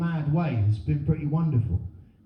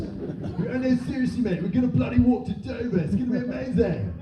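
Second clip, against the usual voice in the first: higher, matey hype; mock eager lad; 5 semitones higher than usual. Acting a young man pitching a walk to mates, mock eager. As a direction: pitch it up like a keen fundraiser.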